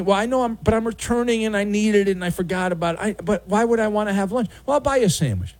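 lightly voiced guilty neighbor backpedaling; flustered neighbor. Thief stammers excuses to the owner, mock flustered.

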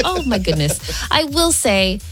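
usual speaking voice

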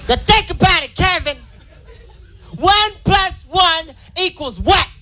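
frustrated mom lecturing her son; angry mom voice. Retold or imitated. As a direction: scold the boy over simple math. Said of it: "She's like".

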